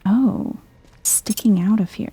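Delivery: ordinary talk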